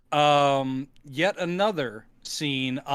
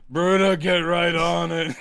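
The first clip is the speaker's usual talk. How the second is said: obedient henchman. Acted. Bruta taking the breakfast order, obedient henchman voice. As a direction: answer like a dutiful servant.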